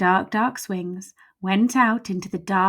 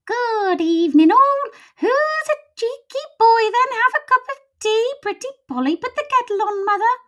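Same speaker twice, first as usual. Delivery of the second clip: much higher, louder squawking parrot voice; parrot skeleton; 7 dB louder than usual. Acted. Parrot skeleton greets visitors, squawky and high. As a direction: chirp it like a cheeky parrot.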